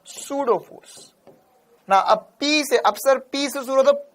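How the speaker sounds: usual speaking voice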